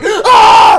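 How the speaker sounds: high, loud mock scream; imitated scream. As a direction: let out a shrill freak-out scream. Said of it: "You were freaking out".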